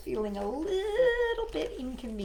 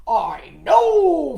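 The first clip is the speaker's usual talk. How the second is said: much louder frog cry; a frog bursting in eagerly; eager Froggy; 4 semitones higher, 15 dB louder than usual. Acted. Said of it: "Froggy cried".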